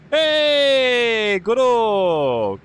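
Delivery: long drawn-out, high "hey" sliding down; loud, stretched-out hail, falling in pitch; big greeting. Real, not acted. Streamer greets a viewer who asked for a hi, big drawn-out greeting.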